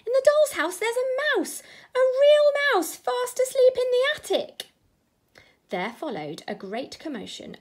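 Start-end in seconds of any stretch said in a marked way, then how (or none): 0.1-4.7 put-on high, loud, thrilled child's voice; excited little boy. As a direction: gush out the news like a delighted little boy. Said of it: "he cried".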